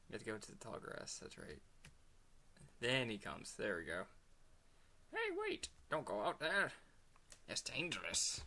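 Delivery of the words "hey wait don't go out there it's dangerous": high-pitched mock alarm, calling out; game character yelling to stop someone; urgent warning. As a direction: call out a hammed-up warning.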